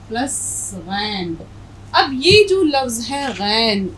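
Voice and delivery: ordinary delivery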